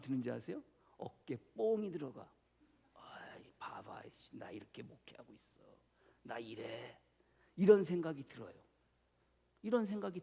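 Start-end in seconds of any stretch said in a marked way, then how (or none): 2.9-7.0 softer, lower, boastful whisper; smug whisper. Pretend. Pastor whispering his proud inner boast, softer and lower. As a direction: brag under your breath.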